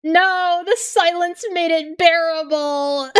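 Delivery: mock her in a high squeak. Mocking squeaky voice.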